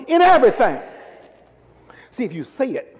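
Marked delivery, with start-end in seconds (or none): 0.1-0.8 raised, higher and louder insistent call; stronger emphasis. Shown as a demonstration. Preacher pressing a weak congregation to say it, stronger emphasis. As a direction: push it out strongly to rouse them. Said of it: "come on, you're not strong".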